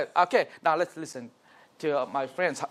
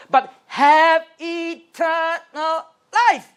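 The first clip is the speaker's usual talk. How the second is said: "eternal life" raised high, louder and slow; emphasize the ending; 9 semitones higher, 5 dB louder, much slower than usual. Shown as a demonstration. Teacher reading John three sixteen, stressing "eternal life". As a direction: lift and stretch "eternal life" to land it. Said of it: "When you want to emphasize".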